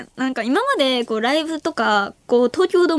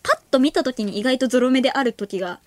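ordinary delivery

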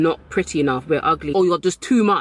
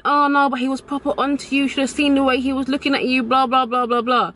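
high-pitched imitation of a pushy friend; insistent friend; 8 semitones higher than usual. Friend kept saying he was onto her, high mock insistence. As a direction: chatter on in a high mimicking voice.

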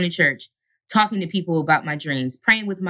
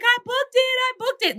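much higher, screaming the news; overjoyed cry. Retold or imitated. Telling her mom she booked the role, overjoyed and high. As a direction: burst out that you got the job. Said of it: "I was like".

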